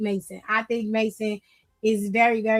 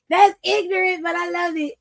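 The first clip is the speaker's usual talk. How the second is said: amused outburst; 6 semitones higher than usual. Real, not acted. Amused reaction to chat joke.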